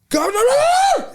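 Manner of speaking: sudden, very high, drawn-out yell of rage; retold furious yell. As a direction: explode on it like a dad who lost his calculator. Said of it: "and he'd go".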